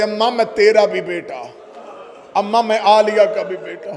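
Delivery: tearful hushed plea. A narrator's reading. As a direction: murmur it to his mother through tears. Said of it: "रो के कहते". (धीमे स्वर में रोते हुए माँ से)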